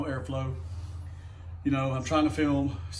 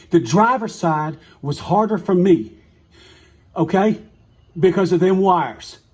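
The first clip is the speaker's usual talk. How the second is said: louder, slower, "driver's" hit hard with annoyance; exasperated correction; 3 semitones higher, 6 dB louder, slower than usual. Real, not acted. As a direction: spell "The driver's side" out slowly and loudly, fed up with himself. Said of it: "Damn, I'm getting aggravated today".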